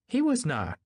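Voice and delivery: high-pitched, indignant denial; firm denial. A narrator's reading. Daisy denying the man was her friend, indignant denial. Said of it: "she denied".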